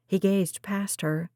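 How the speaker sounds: ordinary delivery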